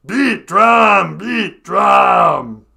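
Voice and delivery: wild Muppet drummer yell; Animal voice. Shown as a demonstration. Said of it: "Animal's the same voice".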